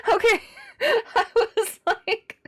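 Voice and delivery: high, loud laughing fit, slowed words. Real, not acted. Gamer laughing at her own blunder, laughing hard. Laughing through a bad game moment.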